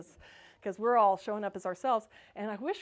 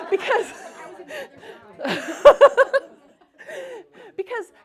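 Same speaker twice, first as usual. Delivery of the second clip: laughing; 12 semitones higher, 10 dB louder than usual. Real, not acted. Laughing while telling story.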